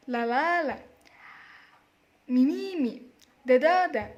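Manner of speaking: "la la la", "mi mi mi", "da da da", each with a loud middle beat; stress on middle syllable. Shown as a demonstration. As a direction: punch the second of each three syllables. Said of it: "press to the second syllable".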